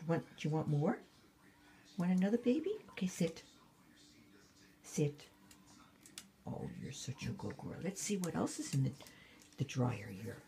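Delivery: talking to a dog. Real, not acted. Dog talk with toys.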